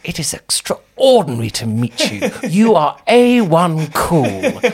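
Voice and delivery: a lightly voiced grand old vicar, slowing down at the end; imitating her dad. Daughter imitating her vicar dad to the host, slow grand voice. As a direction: mimic Dad's slow, grand way of talking. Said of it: "speaks like this".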